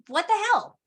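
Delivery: squeaky mock colonist outrage; high mock indignation. Colonists to Parliament, high squeaky mock outrage. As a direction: pitch it way up, fake appalled.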